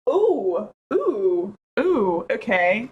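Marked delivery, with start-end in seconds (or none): none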